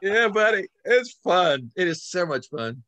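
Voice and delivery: high, loud burst of enthusiasm; excited. Real, not acted. Author to interviewer, bursting with loud high excitement. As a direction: gush about how fun it is. Excited about writing his dragon book.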